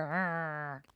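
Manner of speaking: a small bear growling under its breath. A narrator's reading. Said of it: "the little bears growl".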